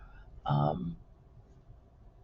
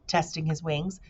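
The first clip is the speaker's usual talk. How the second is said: usual speaking voice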